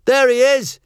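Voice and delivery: high, loud yell of discovery. Acted. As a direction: call it out as you spot him. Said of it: "Josh yelled".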